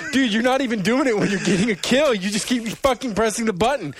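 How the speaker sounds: loud, high mock complaint; angry gamer. Imitating an angry opponent complaining to him, in a loud, high mock whine. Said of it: "They're like".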